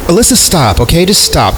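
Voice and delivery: exasperated. Acted. Friend cuts off panicking girl.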